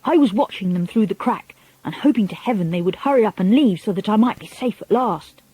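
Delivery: ordinary delivery, nothing marked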